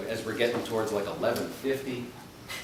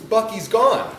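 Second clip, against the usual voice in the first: high, loud wail of a small boy, imitated; mock heartbroken child; upset crying child; 8 semitones higher, 9 dB louder than usual. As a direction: imitate a distraught little kid, pitched up and loud. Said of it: "he was crying".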